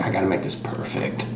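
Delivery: under the breath, unvoiced; inner voice muttered. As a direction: mutter to yourself anxiously. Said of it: "I'm like".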